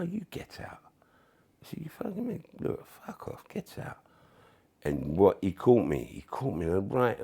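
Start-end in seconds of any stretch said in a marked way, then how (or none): 0.0-4.0 whispered, breath-only angry retort; mock row in a heated whisper; hushed retold argument. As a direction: argue under your breath, retelling it.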